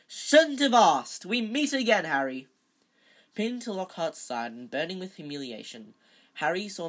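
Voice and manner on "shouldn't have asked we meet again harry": big, loud, high hearty greeting; jovial thundering. A narrator's reading. Celebrity teacher greeting Harry, jovial thundering. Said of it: "thundered jovially".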